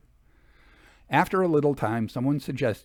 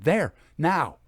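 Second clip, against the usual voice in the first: short, louder calls; exclamation; 4 semitones higher, 4 dB louder than usual. A narrator's reading. Clairvoyant marking each flash of light for the sitters: short, louder calls. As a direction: snap out each sighting. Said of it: "These exclamations".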